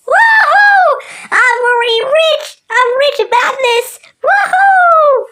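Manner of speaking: high, loud whooping cheer; excited shouting. Acted. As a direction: cheer like you struck gold. Celebrating getting rich.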